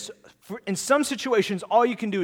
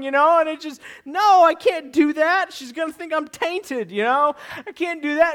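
acted panic; 9 semitones higher, 4 dB louder than usual. Acts out boy dreading confession.

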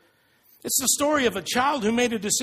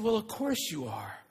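reassuring wife; 3 semitones lower, 7 dB quieter than usual. Retold or imitated. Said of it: "She said".

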